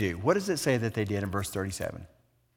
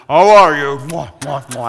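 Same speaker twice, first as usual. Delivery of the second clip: much louder, lightly Romanian hello; Romanian greeting; 2 semitones higher, 15 dB louder than usual. Retold or imitated. Priest greets him outside Starbucks, loud light Romanian accent. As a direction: call it out like the big Romanian priest. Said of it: "deep Romanian voice and accent".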